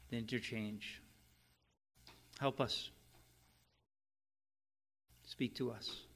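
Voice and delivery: earnest spoken prayer to God. Acted. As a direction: pray it sincerely over the congregation. Pastor leads opening prayer.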